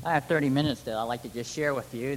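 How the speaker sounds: ordinary talk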